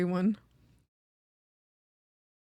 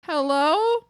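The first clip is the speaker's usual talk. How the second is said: louder, higher rising call; louder incredulous hello; 5 semitones higher, 7 dB louder than usual. Retold or imitated. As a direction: call out in disbelief. Calling out in disbelief.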